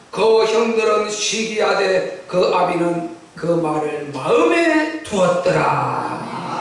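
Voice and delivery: very slow, low intoned verse; scripture reading. A narrator's reading. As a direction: stretch the last words like a solemn reading. (느리게 길게 끄는 성경 봉독)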